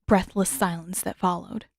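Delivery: usual speaking voice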